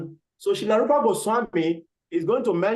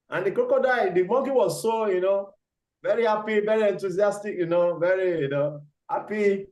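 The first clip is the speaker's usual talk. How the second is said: ordinary talk, nothing marked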